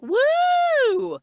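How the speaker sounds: very high, loud whoop; mock cheer. Host imitates guys cheering in the doorway, a loud whoop. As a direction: whoop like a party crowd.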